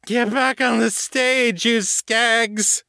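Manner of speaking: very high, drawn-out heckle; drunken heckling shout. A narrator's reading. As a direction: holler at the band from the crowd. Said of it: "she shouted".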